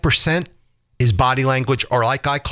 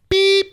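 mock beep; 4 dB louder than usual. Shown as a demonstration. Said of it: "voicemail tone imitated".

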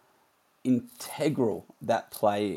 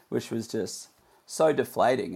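ordinary talk, nothing marked

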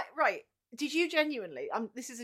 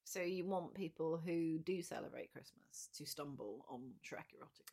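slow, much softer whisper; 5 semitones lower, 9 dB quieter than usual. Real, not acted. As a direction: breathe the tease almost under the breath. Teasing aside about the joke.

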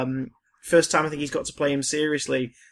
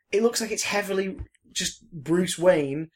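normal talking voice

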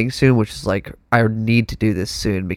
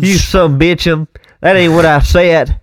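high, loud, drawn-out hammed-up yokel; mock-outraged local; 6 semitones higher, 5 dB louder than usual. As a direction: play at cursing out an impostor. Continues the offended local bit.

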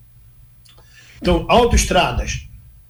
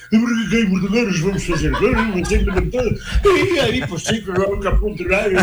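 mock Alentejo accent; 3 semitones higher than usual. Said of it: "entra o sujeito e começa".

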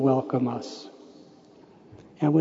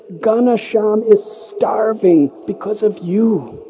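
higher, louder, a retold sadhu's furious scolding; angry scolding; 6 semitones higher, 5 dB louder than usual. Sadhu scolding the young man for eating the rotis, angry and loud. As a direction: scold him as the sadhu did, building. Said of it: "He screamed".

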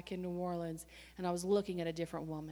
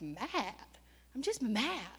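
normal talking voice

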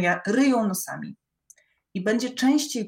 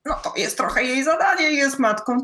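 higher, louder, preachy judgement; judgemental voice; 5 semitones higher, 4 dB louder than usual. Retold or imitated. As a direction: lecture like a disapproving aunt. Voicing society's judgement. (oceniający ton)

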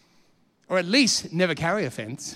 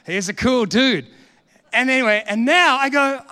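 usual speaking voice

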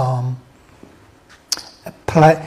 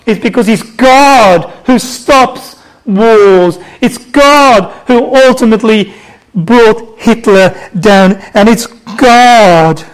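forceful, emphatic pulpit proclamation; fervent sermon with stretched key words; impassioned preaching; 4 semitones higher, 6 dB louder than usual. Real, not acted. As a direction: drive home each line to the congregation.

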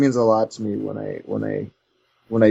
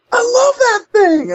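gushing fan; 6 dB louder, slower than usual. Retold or imitated. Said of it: "He's like".

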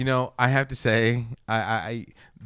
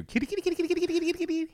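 calling a cat; 6 dB quieter, much faster than usual. Acted.

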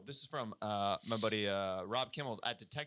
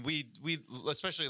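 ordinary talk

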